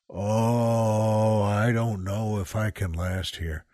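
low, groaned worry to himself; desperate inner groan. Retold or imitated. Retelling his need to pee in traffic, desperate groan. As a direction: moan it low, as if barely holding on.

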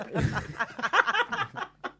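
high, helpless laughter; real high-pitched giggling fit; laughing fit. Real, not acted. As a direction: break up laughing mid-sentence. Host breaks up laughing mid-list.

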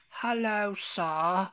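high mock British greeting; generic British accent. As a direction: imitate an American's stock British accent. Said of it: "it's usually just the generic".

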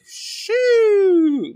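high, long drawn-out whistle of a word; impressed exclamation. Retold or imitated. Her reacting on sight of him, impressed exclamation. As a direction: stretch it out high, mock-swooning.

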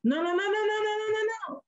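high-pitched imitation of an objecting mentor; rapid-fire dismissal. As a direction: wave the answer away in one high string of no's. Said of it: "That's how he said it".

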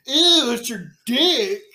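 high, loud, drawn-out fake shock; mock surprise. Stepsister feigns surprise at stepbrother, high loud slow squeal. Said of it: "the stepsister acts all surprised".

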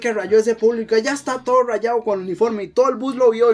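accusing security guard. Retold or imitated. Guard denouncing the student to the teacher, high mock-accusing voice. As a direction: play an indignant guard reporting a kid.

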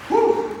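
high, loud relieved whew. Real, not acted. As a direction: blow out a big whew. Exclaims on receiving award.

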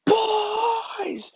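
high, drawn-out shout; dad's angry bellow. Retold or imitated. Dad calling his sons from downstairs, long angry shout. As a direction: holler for the kids like an upset father. Dad arrives home furious.

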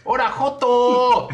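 high, loud, long-drawn heckle; imitated street shout. Showing friends how strangers yelled at him, mocking shout. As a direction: demonstrate the jeer, vowels stretched high and loud. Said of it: "¿Cómo te gritaban?".

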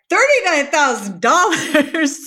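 shocked. Retold or imitated. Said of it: "And I'm like".

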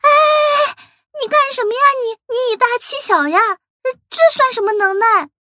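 high, fast indignant little creature; protesting sea cucumber. Acted. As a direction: protest shrilly from below. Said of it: "他抗议地说".

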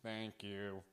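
very soft, synthetic-sounding thank you; flat robotic voice, barely audible; Stephen Hawking voice. Retold or imitated. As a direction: imitate a speech computer. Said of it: "he has to".

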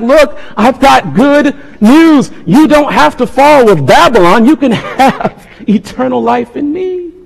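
high, impassioned plea; earnest appeal, pitched well up and drawn out; urgent pleading. Acted. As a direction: plead as if offering a last rescue. Acts Jesus pleading with listeners.

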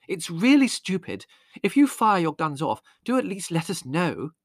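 trembling mother reprimanding her son; mother's reprimand. A narrator's reading. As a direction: scold your son, shaken. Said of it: "reprimanded Leslie severely".

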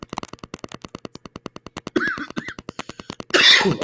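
sudden choking cough; real coughing fit. Real, not acted. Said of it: "sangria going down the wrong pipe".

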